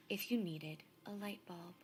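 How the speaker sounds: ordinary delivery, nothing marked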